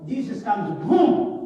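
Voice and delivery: loud burst on boom; sudden boom. Acted. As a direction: shout boom like a sudden appearance. Jesus suddenly appears.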